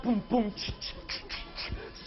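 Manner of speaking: mouthed boom boom and ticking hi-hat; beatbox a cheap synth beat; drum machine noises. Acted. Futurist singer imitating his drum machine beat.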